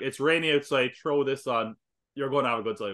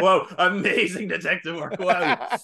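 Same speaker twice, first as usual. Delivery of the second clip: sarcastic; 5 semitones higher, 5 dB louder, slower than usual. Acted. Sarcastic praise of detective.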